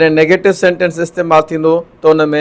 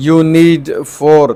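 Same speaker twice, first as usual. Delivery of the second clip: slow dictation; much slower than usual. Shown as a demonstration. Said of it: "هتي ڇا ٿو چئي".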